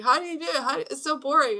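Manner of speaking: put-on high, loud complaining kid voice; whiny son. Mother imitates her son's complaint about meditation, high and loud. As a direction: whine it like a bored boy. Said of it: "And he was like".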